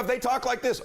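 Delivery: high-pitched, pushed-up voice; big-volume talker. Shown as a demonstration. Showing listeners a client's volume, big and high. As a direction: pitch it way up like an over-energetic talker. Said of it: "If they talk at a certain volume".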